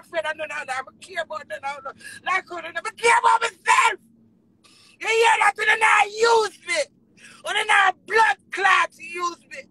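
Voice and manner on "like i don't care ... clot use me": real anguished anger. Real, not acted. Performer protesting that they are using her, anguished. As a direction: wail it out in loud protest.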